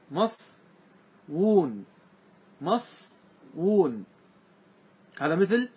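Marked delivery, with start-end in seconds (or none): 0.1-4.0 مصوون stretched very slowly, the double w sounded out; slowly drawn out. Shown as a demonstration. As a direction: draw out مصوون to show the rare form. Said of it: "قالوا".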